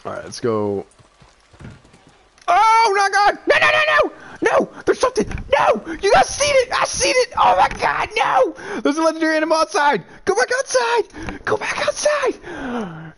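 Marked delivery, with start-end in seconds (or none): 2.5-13.1 high, loud frantic shrieking; panicked screaming. Real, not acted. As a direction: scream it out in real panic as your chance slips away. Panic at legendary animal spawning.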